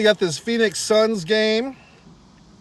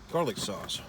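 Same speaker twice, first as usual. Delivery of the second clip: low mutter; 9 semitones lower, 10 dB quieter than usual. Real, not acted. Muttered craving aside.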